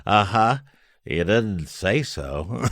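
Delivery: slow, dry deadpan Bob voice; Bob impression. Repeating Bob's punchline for the host, slow dry impression. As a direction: savour the line slowly like Bob. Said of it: "Pretty good Bob, by the way".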